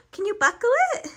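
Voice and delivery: gasp, then cheer her on; excited gasp. Real, not acted. Mom encouraging toddler excitedly.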